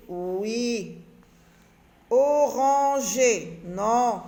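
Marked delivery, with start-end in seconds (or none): 2.1-3.6 "Oranger" very slow and louder, each syllable held; drawn-out syllables. Shown as a demonstration. Teacher testing a word against olivier, "Oranger" slowly sounded out. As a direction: stretch "Oranger" syllable by syllable, loudly.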